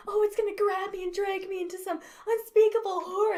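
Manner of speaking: hammed-up horror narration, pitched high; mock the breathless melodrama; exaggerated high-pitched dramatic quote; overdramatic mocking. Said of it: "describe that as a normal human being".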